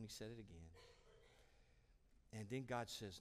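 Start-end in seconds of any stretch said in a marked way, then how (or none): none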